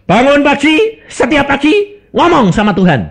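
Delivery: loud, high call to wake up and pray; firm command. Retold or imitated. Preacher commands mute woman to pray, loud. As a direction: bark the order across the room.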